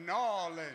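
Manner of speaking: New Orleans clipped to one word while laughing; Nawlins with a laugh. Shown as a demonstration. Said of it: "One word".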